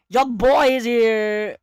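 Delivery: loud, stretched-out hype call; big, drawn-out shout; hyped announcement. Real, not acted. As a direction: belt it out slowly to the whole chat. Hyped greeting to chat.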